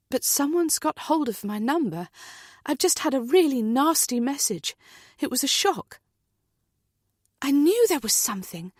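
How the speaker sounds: anxious, rattled voice; worried friend, shaken up; upset woman. Acted. Heather upset on the phone.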